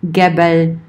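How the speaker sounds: "gebel" starting with a hard g as in "get"; "gebel" said GEH-bel with hard g. Shown as a demonstration. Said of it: "depending on the dialect".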